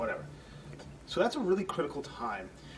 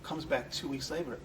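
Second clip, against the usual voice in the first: ordinary delivery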